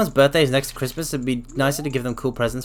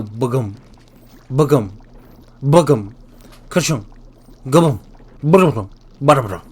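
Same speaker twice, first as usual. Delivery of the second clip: clock noises; 4 dB louder than usual. Shown as a demonstration. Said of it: "the kind of noise they make".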